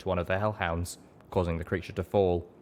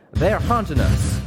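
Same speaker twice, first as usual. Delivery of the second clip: much higher, louder, slow; desperate shout; 10 semitones higher, 9 dB louder, slower than usual. A narrator's reading. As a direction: roar it out urgently. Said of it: "Calamity shouted".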